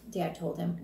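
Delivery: ordinary delivery, nothing marked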